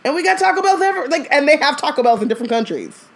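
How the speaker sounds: very high, fast disbelief; incredulous retort. Real, not acted. Host scoffs at her cousin, very high and fast.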